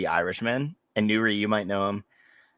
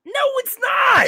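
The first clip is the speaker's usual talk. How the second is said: high loud yelled denial; playful shouting match; 7 dB louder than usual. Real, not acted. Arguing with Jason over grits, high playful yell. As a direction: yell it right back.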